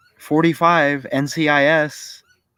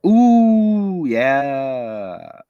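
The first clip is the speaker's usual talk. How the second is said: impressed ooh. Real, not acted. Reacting to something on screen.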